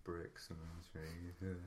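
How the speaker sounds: quiet mumble to himself, under his breath; mumbling. Retold or imitated. Tired man mumbling on the walk home, quiet. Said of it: "some guy going".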